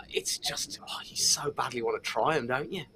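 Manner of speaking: low, breathless whisper. Acted. Host confessing to viewers he craves the beers, whispered. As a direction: whisper the longing like a secret. Conspiratorial aside about craving.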